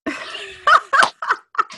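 high teary laugh. Real, not acted. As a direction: laugh through tears. Laughing at her tears.

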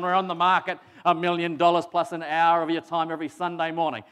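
loud, fast auctioneer patter. Pretend. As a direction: work the room like an auctioneer. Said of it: "start off, however, with an auction this morning".

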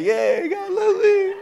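high, drawn-out mock glee; squeaky put-on delight, stretched out; hammed-up smiley voice. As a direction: imitate a beaming, over-sweet churchgoer. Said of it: "You can hear the smiles, like".

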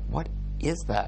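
replaying his own confusion; imitating his baffled self. Vlogger reenacting his reaction to hotel noises, mock baffled. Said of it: "so many noises in this hotel that I'm like".

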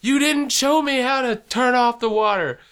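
high-pitched mock wife yelling; imitated yelling back. Wife yelling back at husband during the leak, imitated yelling. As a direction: holler it back as a high, aggrieved impression. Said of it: "she's yelling back at me".

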